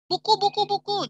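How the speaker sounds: high, loud eager answer; eager students. Retold or imitated. Students eagerly answering the teacher, high and loud. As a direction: shout it out first. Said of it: "Mereka akan jawab".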